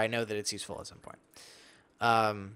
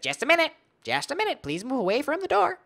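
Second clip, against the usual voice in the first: hermit voice; 13 semitones higher, 5 dB louder than usual. Acted. Voicing the hermit inside.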